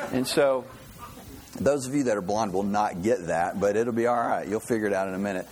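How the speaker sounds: ordinary talk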